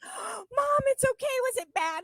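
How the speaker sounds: put-on high, anxious child's voice; a daughter soothing her crying mom; high worried daughter. Daughter comforting her sobbing mom, high and anxious. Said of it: "she's like".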